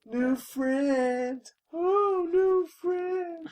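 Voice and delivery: very high, drawn-out falsetto mimicry; Friend impression. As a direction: coo it in long, high, silly tones. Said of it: "the guy who played Friend".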